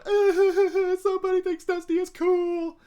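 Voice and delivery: very high, loud, laughing squeal; overwhelmed to tears with happiness; teary joyful squeal. Retold or imitated.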